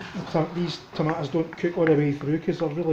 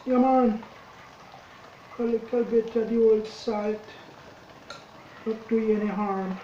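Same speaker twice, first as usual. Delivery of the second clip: much higher, slow, hammed-up Jamaican Caribbean accent; 6 semitones higher, slower than usual. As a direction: stretch it out in a goofy Jamaican accent. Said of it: "That's me doing a wee bit of Jamaican Caribbean accent there".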